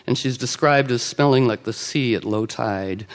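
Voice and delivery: ordinary delivery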